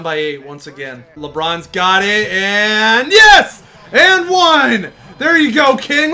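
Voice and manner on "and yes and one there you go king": loud, high cheer; excited cheering. Real, not acted. Gamer cheering LeBron's and-one, loud and high. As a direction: cheer the big bucket.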